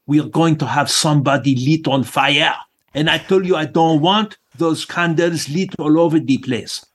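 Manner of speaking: lightly voiced French pastry chef grumbling; a hint of a French accent, fussy and firm. Pastry chef warns the staff about candles in a light French accent. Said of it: "in his French accent".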